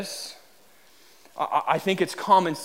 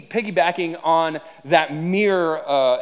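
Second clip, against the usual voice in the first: ordinary delivery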